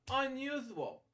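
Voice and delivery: ordinary delivery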